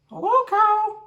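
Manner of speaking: very high squeaky duck greeting. Acted. Ruben voicing Duck greeting Cow, squeaky high. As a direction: pipe it up like a cheerful cartoon duck. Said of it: "we have to use the voices".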